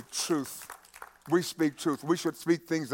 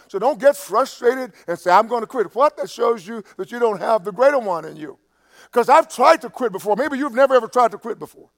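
higher, louder pulpit exhortation; impassioned preaching; 8 semitones higher, 7 dB louder than usual. Real, not acted. Preacher urging believers not to quit, impassioned. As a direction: press it on the congregation with force.

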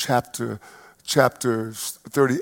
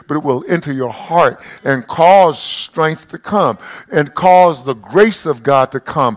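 louder, rising sermon cadence; preaching at full force; 3 semitones higher, 5 dB louder than usual. Acted. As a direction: preach it out to the congregation.

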